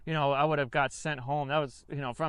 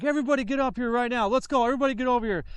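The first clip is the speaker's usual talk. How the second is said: drill sergeant bark; 6 semitones higher, faster than usual. Retold or imitated. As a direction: bark the order at the recruits. Drill sergeant calls recruits up.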